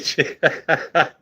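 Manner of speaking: real high-pitched laugh. Real, not acted. Laughs at his own talk.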